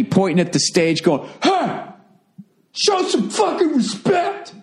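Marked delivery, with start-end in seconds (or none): 1.4-4.5 high, slow mock shout of an angry heckler; imitated heckler yell. Comedian imitating the heckler yelling at him, high mock shout. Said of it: "He starts yelling at me".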